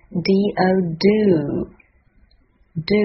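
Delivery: very slow, model "do" for learners; pronunciation demo. Shown as a demonstration. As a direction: spell D O, then a drawn-out "do". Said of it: "现在我们来看看发音的部分".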